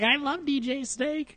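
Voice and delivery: high-pitched, drawn-out mock fangirl; gushing girlfriend. Host mocking girlfriends at a dubstep concert, high gushing voice. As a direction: squeal it slowly, far up in pitch. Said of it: "their girlfriend, like".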